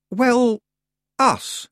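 flustered. Acted. Flustered correction.

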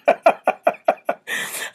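voiceless wheezing laugh; real helpless cackling; laughing hard. Real, not acted. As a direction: laugh until only air comes out. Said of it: "I'm laughing so".

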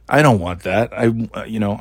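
usual speaking voice

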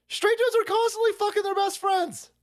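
high mocking caricature. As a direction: ham it up in a high voice.

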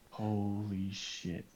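slow, hushed, drawn-out shock; softly stunned, very slowly; stunned disbelief. Retold or imitated. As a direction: stretch each word out softly in retold dismay. Retold stunned reaction to threatened charges.

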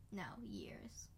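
low joking whisper. Pretend. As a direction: whisper the joke.

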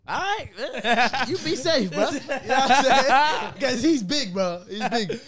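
high, loud words through laughter; laughing. Real, not acted. Retelling his reply to a worried friend, laughing loudly. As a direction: crack up while giving the advice.

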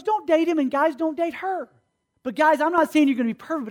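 usual speaking voice

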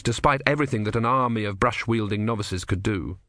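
ordinary delivery

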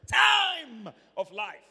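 "Time" shouted high and held long; booming, drawn-out "Time", then drop on "of life"; stress on TIME. Shown as a demonstration. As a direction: stretch "Time" into a long, loud cry. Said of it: "My emphasis on time".

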